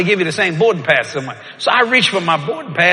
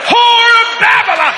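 loud shout; 7 dB louder than usual. Retold or imitated. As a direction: yell it as loud as you can. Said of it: "I said it as loud as I could".

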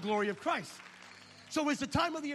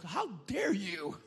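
mock offended; much slower than usual.

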